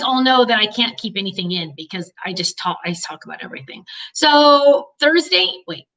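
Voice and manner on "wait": ordinary talk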